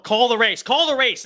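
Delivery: loud, high, thrilled calling of the race; gleeful victory cry; excited shout. Real, not acted. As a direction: demand it like victory is sealed. Excited streamer calling election win.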